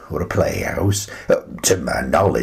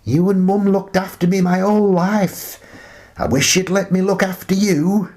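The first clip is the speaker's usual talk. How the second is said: daughter's voice; 12 semitones higher than usual. Acted. Old man voicing Shannon pleading with her father. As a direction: beg to care for him, daughter-like. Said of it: "She often tells me".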